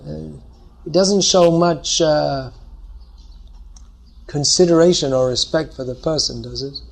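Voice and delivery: ordinary delivery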